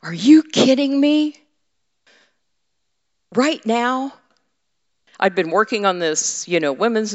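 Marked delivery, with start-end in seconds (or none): 0.0-4.2 incredulous. Retold or imitated. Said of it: "And I'm like".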